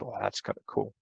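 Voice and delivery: imitating a listener, hushed and low; quiet mock reaction; low muttered aside. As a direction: drop to a soft, barely voiced murmur. Voicing an unimpressed listener's reaction.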